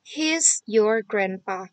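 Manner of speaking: very slow and distinct; a teacher showing children how to read the answer; slow model reading. Shown as a demonstration. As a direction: read it out word by word. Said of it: "jadi jawabannya".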